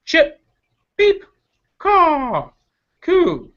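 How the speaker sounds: high chirps and caws in turn; bird calls. Acted. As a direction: rattle off the birdsong.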